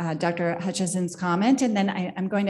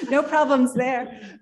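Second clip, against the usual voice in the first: laughing; 5 semitones higher, 5 dB louder, slower than usual. Real, not acted. Laughs at own teasing remark.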